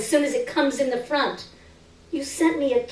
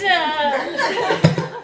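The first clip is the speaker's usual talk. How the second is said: laughing; 7 semitones higher than usual. Real, not acted. Reader breaks up mid-sentence.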